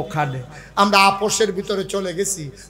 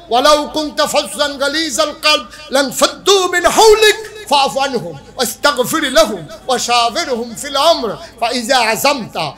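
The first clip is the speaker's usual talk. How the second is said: raised, forceful Arabic recitation; ringing scripture quotation, easing at the close; Quranic recitation; 6 semitones higher, 6 dB louder than usual. Acted. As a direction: recite the verse with pulpit fervour.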